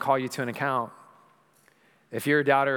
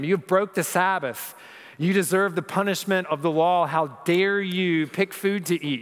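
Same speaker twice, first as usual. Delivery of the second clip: accusing Pharisees; 6 semitones higher, 3 dB louder than usual. Acted. Preacher voicing accusing Pharisees.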